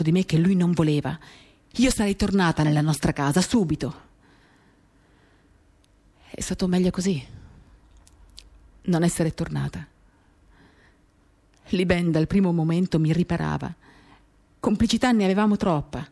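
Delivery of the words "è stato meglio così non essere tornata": ordinary talk, nothing marked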